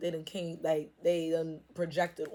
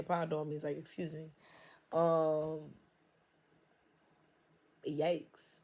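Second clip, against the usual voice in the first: usual speaking voice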